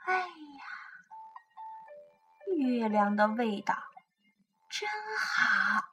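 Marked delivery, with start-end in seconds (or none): none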